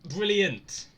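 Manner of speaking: loud, high sarcastic exclaim; bitterly sarcastic outburst. Real, not acted. Sarcastic reaction in horror game.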